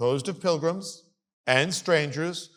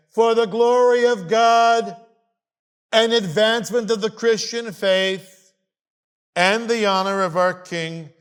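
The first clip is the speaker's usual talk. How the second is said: loud, raised, slow declamation, easing toward the end; emphatic reading; 6 semitones higher, 6 dB louder than usual. Acted. Lecturer reading the compact's reasons to a class, loud and emphatic. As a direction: proclaim the document, weighting each phrase.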